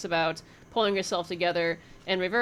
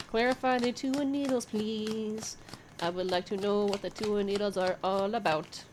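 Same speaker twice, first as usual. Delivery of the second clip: exaggerated polite request; 3 dB quieter than usual. Reader pleading with her cards for clarification, mock-polite. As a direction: ask again with pointed, deliberate courtesy.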